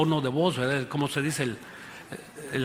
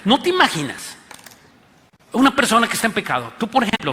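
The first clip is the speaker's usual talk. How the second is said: louder, higher, fast preacher's push; fiery preaching; 5 semitones higher, 5 dB louder, faster than usual. Real, not acted. Preacher warning sinners in the congregation, louder and higher. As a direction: drive the point home to the crowd.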